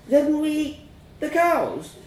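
put-on high, halting child's question; dismayed child. A narrator's reading. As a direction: piece it together in childish dismay. Child works out the truth.

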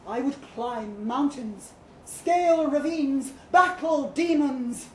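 rising to loud, slow proud declarations; building boast. A narrator's reading. Statue boasting his feats to her, building to loud. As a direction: swell from proud to thunderous. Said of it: "Mercury boasted".

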